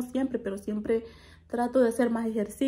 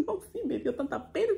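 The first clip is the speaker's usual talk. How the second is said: softer, laughing through the words; embarrassed laughing; 7 semitones higher, 4 dB quieter than usual. Real, not acted. Admitting to viewers she was embarrassed, laughing. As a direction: laugh while owning up to it.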